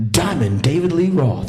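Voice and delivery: loud showman's self-introduction. Retold or imitated. Rock singer introducing himself, loud showman voice. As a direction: announce your own name with swagger. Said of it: "he says".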